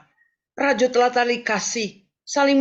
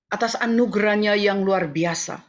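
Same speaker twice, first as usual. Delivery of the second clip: usual speaking voice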